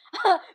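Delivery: a sudden high, loud, cry-like burst of laughter; real laugh. Real, not acted. Seeing her messy hair, a loud cry-like laugh. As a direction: break into laughter at yourself.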